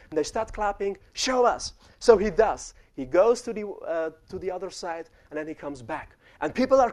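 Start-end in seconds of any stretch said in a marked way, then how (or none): 1.1-1.7 higher, urging shout of a crowd; mock crowd urging; eager crowd call. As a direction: egg him on like the crowd.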